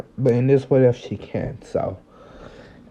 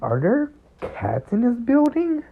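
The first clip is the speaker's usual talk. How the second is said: high, puzzled question; a baffled pitch jump; confused; 10 semitones higher, 3 dB quieter than usual. Acted. Ochako hearing meows, asking in high confusion. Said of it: "somewhat confused".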